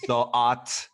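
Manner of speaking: put-on loud French-accented exclamation. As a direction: play it as a mock Frenchman. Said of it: "I want him to be French now".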